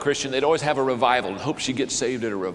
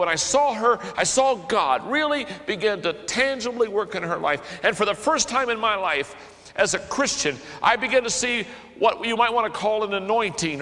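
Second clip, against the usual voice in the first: high, loud preaching build; preacher building to the moment; impassioned preaching; 7 semitones higher, 4 dB louder than usual. Real, not acted. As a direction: preach it with rising fire.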